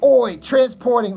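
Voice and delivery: high, drawn-out fake Scots-Aussie call; botched Scottish accent. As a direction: attempt Scottish, slip into Australian. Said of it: "that's Australian. I, I can't do a Scottish accent".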